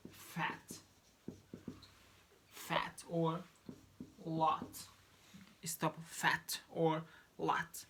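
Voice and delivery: fat and lot with the final T held and not released; stop T. Shown as a demonstration. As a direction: end fat and lot on an unreleased T. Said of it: "stop T".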